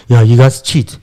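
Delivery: put-on Afrikaans accent. Retelling a colleague's morning jibe in an Afrikaans accent. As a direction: quote him in a mock Afrikaans accent. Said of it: "That's an Afrikaans accent".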